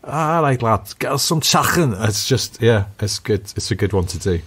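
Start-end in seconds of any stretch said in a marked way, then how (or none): 0.0-2.0 higher-pitched mock Scouse lad; Liverpudlian accent. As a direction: play a Scouser calling for food, throaty ch. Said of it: "say with a Liverpudlian accent".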